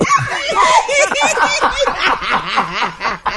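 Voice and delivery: high howling laugh; big laugh. Real, not acted. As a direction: burst out laughing. Loud laughter.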